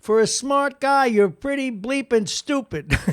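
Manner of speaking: loud, high Brooklyn coach yelling at a player; Brooklyn coach accent. Retold or imitated. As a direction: yell it like a tough Brooklyn coach. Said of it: "he had a real Brooklyn accent".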